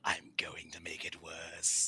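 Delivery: hushed breathy whisper. Real, not acted. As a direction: whisper it under your breath. Whispered aside at table.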